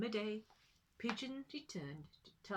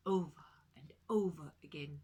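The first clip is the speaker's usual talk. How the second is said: whisper; 4 semitones lower, 6 dB louder than usual. A narrator's reading. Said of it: "whispered narration".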